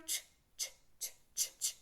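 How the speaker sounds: unvoiced whispered ch, ch; breathy train-like ch repeated; ch sound demo. Shown as a demonstration. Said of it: "Choo-choo".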